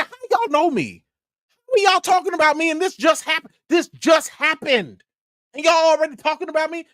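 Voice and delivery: high, fast stunned questions; excited disbelief. Retold or imitated. Vlogger replaying his excited shock on the phone, high and fast. Shocked they already know him.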